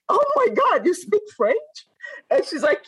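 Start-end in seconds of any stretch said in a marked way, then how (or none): 0.1-1.8 much higher, thrilled squeal of recognition; delighted surprise, pitched way up; mock-thrilled shriek of joy; excited surprise. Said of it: "And so I was like".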